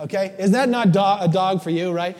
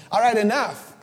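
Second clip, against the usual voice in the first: louder, higher, impatient telling the dog off; fed-up owner; 3 semitones higher, 5 dB louder than usual. Retold or imitated. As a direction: shoo away the clingy dog. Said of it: "finally I'm like".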